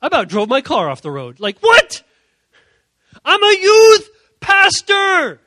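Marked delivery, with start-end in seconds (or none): 1.6-5.4 very high, loud, stretched outburst; shocked yelling. Acted. Youth pastor shouts back at God, shocked and indignant. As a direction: explode in disbelief.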